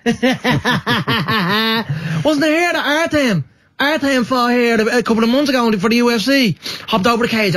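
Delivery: high, lilting Dublin fighter voice; Conor McGregor. Shown as a demonstration. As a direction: boast in a high Crumlin accent. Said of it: "I'm still studying McGregor".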